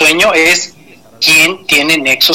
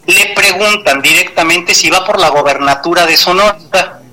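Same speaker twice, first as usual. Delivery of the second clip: ordinary talk, nothing marked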